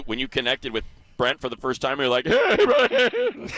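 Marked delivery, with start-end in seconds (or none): 2.3-3.5 mock tearful greeting. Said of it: "So were you crying when you".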